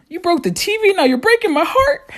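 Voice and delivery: high-pitched wounded whine; mock heartbroken. Brother hurt by sister's insult, mock heartbroken.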